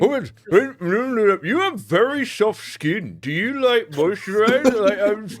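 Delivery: high, loopy, rambling drugged voice; dazed drugged guard. Acted. GM voicing a drugged guard to the players, high and loopy. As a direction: babble like a sedated guard who's lost the plot.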